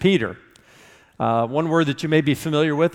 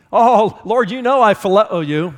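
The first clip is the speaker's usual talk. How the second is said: higher, louder earnest protest; pleading Peter; 6 semitones higher, 6 dB louder than usual. Acted. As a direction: beg him to believe you. Peter answers in Greek retelling.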